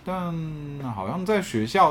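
usual speaking voice

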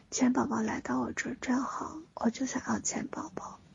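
put-on soft childish begging whisper; cutesy whiny pleading. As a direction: wheedle like a spoiled little kid. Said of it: "还有这份撒娇的这份感觉".

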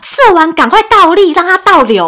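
mock mom. Friend voicing mom's advice.

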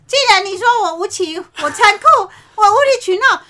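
rising, swelling soap-opera defiance; melodramatic heroine. Pretend. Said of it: "那你要角色扮演啊".

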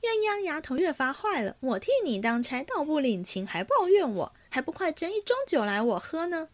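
high, quick teasing reproach; mock-complain briskly, pitched well up; teasing mistress's rapid grumble; playful scolding. A narrator's reading. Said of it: "凤姐笑道".

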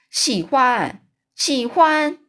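loud, high scornful repeat; mocking yell. Giant yelling at the BFG about the snozzcumber, loud scorn. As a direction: throw the word back with a shout. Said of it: "嚷嚷着说".

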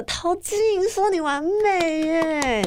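slow, drawn-out awe; friends' amazed quote. Retold or imitated. Friends marvel at the praise, slow mock awe. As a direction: stretch it out in disbelief. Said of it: "朋友都会觉得说".

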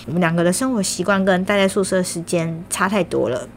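ordinary delivery

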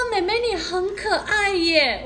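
half laughing as she imitates the flirty taxi driver; laughing quote. Retelling the taxi driver's flirty line, giggling. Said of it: "他说".

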